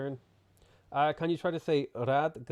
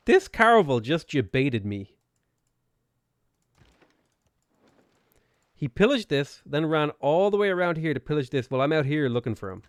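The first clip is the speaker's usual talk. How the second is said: louder, higher indignant rant at the enemy ship; outraged rant; 3 semitones higher, 7 dB louder than usual. Real, not acted. Streamer fuming at a raiding ship, loud indignant rant. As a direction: vent at the sneaky ship like it betrayed you.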